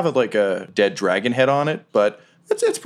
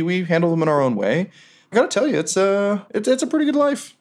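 higher-pitched, earnest sailor voice; contented ghost crewman; 7 semitones higher than usual. Acted. Boots telling the old captain about afterlife, contented.

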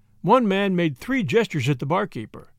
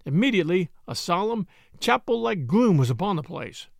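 plain reading voice

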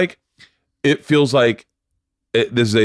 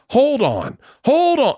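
urgent hold on; 12 semitones higher, slower than usual. Retold or imitated. Manager scrambling after refusal.

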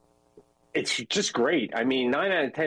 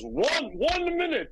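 German lady; 13 semitones higher, slower than usual. Retold or imitated. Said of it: "And then she goes".

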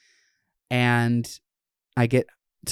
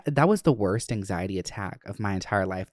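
ordinary delivery

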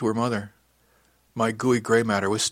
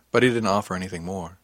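ordinary delivery, nothing marked

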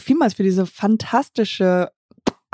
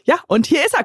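normal talking voice

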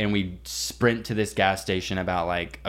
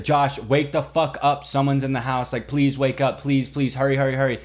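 urgent calling; 4 semitones higher than usual. Retold or imitated. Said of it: "screaming for Josh".

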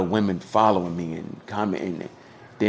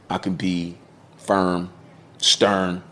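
ordinary talk, nothing marked

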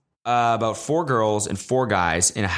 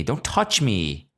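exasperated outburst; 9 semitones higher than usual. Retold or imitated. Rant climax at kid.

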